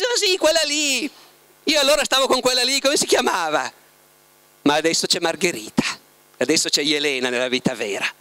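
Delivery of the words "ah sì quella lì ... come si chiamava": high, fast, distant dismissive voice; dismissive Bulgakov. Retold or imitated. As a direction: brush off the ex-wife carelessly. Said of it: "E parlando della moglie precedente, dice". (Bulgakov che liquida la ex moglie con sufficienza)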